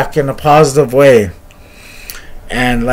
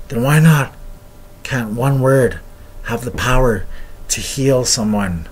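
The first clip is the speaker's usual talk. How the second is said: lower, softer, slowing healer's voice; softened elder; 4 semitones lower, 7 dB quieter than usual. Retold or imitated. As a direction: ease down into a gentle, quiet question. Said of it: "his face softened".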